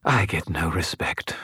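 sighing, put-upon spirit; sighing skull voice. A narrator's reading. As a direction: sigh it like a neglected skull. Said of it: "Bob sighed".

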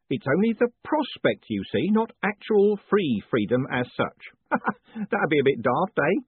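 a beaming prison warden teasing his prisoner; jovial warden. A narrator's reading. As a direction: play the cheerful warden gloating kindly. Warden jokes.

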